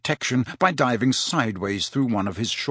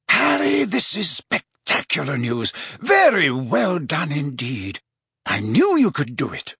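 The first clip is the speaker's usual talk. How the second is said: delighted Dumbledore; 2 semitones higher than usual. Acted. Dumbledore to Harry who got the memory, delighted. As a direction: savour the good news slowly and proudly.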